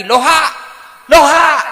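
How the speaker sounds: very loud, high comic bellow; mock phone shouting. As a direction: imitate a clueless caller yelling. Caller shouts hello backwards. (meniru teriakan penelepon, sangat keras)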